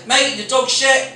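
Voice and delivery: blunt. Retold or imitated. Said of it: "and I'll say".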